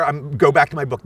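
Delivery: ordinary talk, nothing marked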